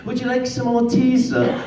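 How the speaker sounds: high, prim tea-party voice of a small girl playing royalty; posh little girl. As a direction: pinkie up, dainty and high. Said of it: "We pretended we were royalty".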